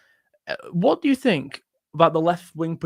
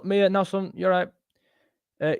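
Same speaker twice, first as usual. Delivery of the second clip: ordinary talk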